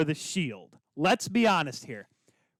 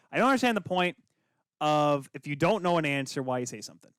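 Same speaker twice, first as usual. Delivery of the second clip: usual speaking voice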